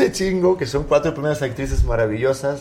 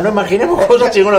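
Chicharito impression; 6 semitones higher, 7 dB louder than usual. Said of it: "decía Chicharito".

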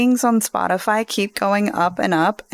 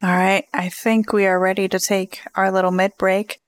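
normal talking voice

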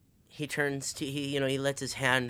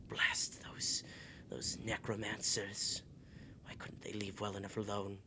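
under his breath; 3 semitones lower, 8 dB quieter than usual. Acted. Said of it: "kind of under his breath".

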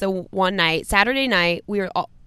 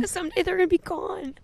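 higher, softer words choked by tears; trying to talk while crying; real crying; 9 semitones higher, 4 dB quieter than usual. Real, not acted. Explaining to her co-host through real tears. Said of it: "she's really crying".

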